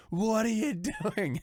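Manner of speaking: mock crackhead. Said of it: "comes in and goes".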